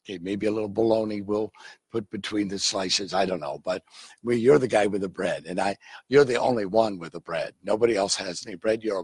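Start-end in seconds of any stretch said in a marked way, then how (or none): none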